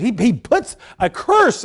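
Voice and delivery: ordinary talk, nothing marked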